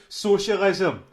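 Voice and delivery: loud deadpan answer; loud punchline. Retold or imitated. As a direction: land the word loudly. His own punchline answer.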